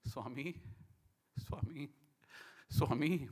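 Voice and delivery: soft, broken-up calls, re-enacting tears; retold crying. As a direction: call out weakly through re-enacted tears. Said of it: "Why am I crying?".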